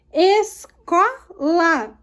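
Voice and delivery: "Escola" very slowly in separate syllables, CÓ stressed. Shown as a demonstration. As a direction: break "Escola" into ES-CÓ-LA, leaning on CÓ. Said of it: "O có é a sílaba tônica". ("Escola" sílaba por sílaba, com força no CÓ)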